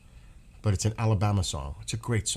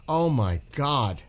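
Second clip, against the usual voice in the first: slow, choked-up awe; emotional; 4 semitones higher, much slower than usual. Real, not acted. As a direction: overcome with feeling, drawn out. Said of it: "you're making me getting emotional now".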